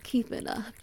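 usual speaking voice

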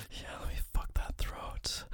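unvoiced whisper, very soft; breathy whisper. Lover whispering to his partner, very softly whispered. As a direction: drop to a bare intimate whisper. Intimate roleplay, whispered repeat.

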